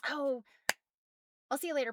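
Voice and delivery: ordinary delivery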